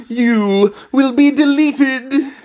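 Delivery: slow, high, robotic villain boom; Bass voice impression. Viewer asks for Bass, so a slow high villain voice. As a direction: announce doom like a cartoon robot. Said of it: "Will you do the voice impression of Bass?".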